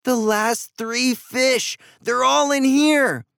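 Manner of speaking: high, drawn-out amazed exclamation; gasped discovery. A narrator's reading. Andy discovering the last fish in the tank, excited gasp. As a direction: build from a high gasp to a thrilled cry. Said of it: "then gasped".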